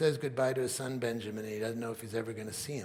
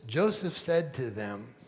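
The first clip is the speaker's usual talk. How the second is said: ordinary talk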